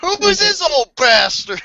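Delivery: high, loud, drawn-out demand; mock outraged character. Inmate confronting an old stranger, mock outrage.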